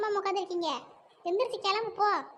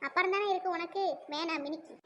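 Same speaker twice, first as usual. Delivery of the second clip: ordinary talk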